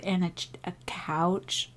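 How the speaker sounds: a soft breathy whisper for and a ch-, a couch; playful hushed aside. Real, not acted. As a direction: drop to a whisper. Listing items in the doll scene.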